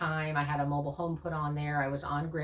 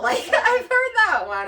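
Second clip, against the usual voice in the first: high, loud laughing delivery; amused squeal of words through a laugh; 11 dB louder than usual. Real, not acted. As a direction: burst into laughter while talking. Laughing at criticism of her drill.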